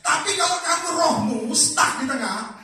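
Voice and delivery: loud, high, driving sermon; fiery preaching. Acted. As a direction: preach it hard to the congregation. Preacher at full force. (khotbah berapi-api, keras dan tinggi)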